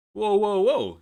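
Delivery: high, loud alarmed whoa; real shock at the quote, loud and high; startled whoa. Real, not acted. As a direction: pull up short, loud and high.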